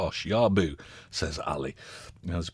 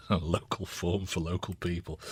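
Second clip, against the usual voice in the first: softer, odd timbre, sinister local shopkeeper; put-on catchphrase voice; 5 dB quieter than usual. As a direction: say it like a creepy village shopkeeper.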